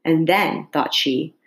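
ordinary delivery, nothing marked

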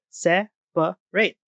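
"se-pa-rate" said slowly, three syllables apart; slow "se-pa-rate", the middle uh heard. Shown as a demonstration. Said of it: "Or with separate".

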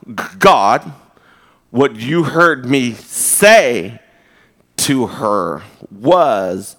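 drawn-out, over-deliberate excuse; sarcastically slow. Retold or imitated. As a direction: lay it out one word at a time, pointedly. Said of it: "I say things slower, which is sarcastic".

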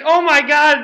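high, loud mock shriek; panicked old lady. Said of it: "having a meltdown".

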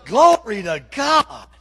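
loud, slow fiery preaching. Acted. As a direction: shout it like a revival preacher. Preaching at full force.

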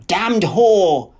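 explosive angry abuse; furious curse. A narrator's reading. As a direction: curse them at full voice. Man raging at her.

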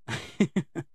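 a quick high chuckle; short real laugh. Real, not acted. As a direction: laugh at yourself after a confession. Laughing about dropped TV.

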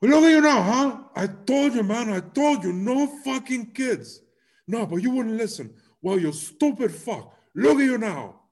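high, loud furious yelling; angry gangster shout. Retold or imitated. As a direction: scream it like a furious thug.

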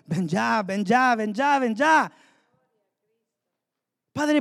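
usual speaking voice

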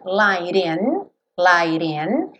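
light in said "lairin" with a tapped T; "light in" as "laiɾ en"; flapped T. Shown as a demonstration. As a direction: tap the T of light into in. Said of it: "ele juntou com rararã".

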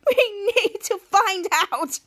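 slow, high-pitched character voice; playful retold reply. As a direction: stretch it out, high and mocking. Kids' gleeful reply, retold.

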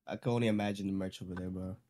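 usual speaking voice

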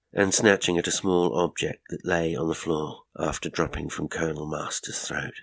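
usual speaking voice

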